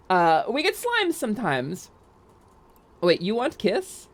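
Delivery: ordinary talk, nothing marked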